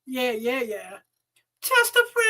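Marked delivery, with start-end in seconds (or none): 1.6-2.2 high shouted hype word. Real, not acted. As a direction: belt it out like a hype man. Hype shout after ad read.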